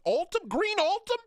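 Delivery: squeaky high incredulous question; surprised. Real, not acted. Streamer reading the queue, squeaking in disbelief. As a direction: jump way up in disbelief.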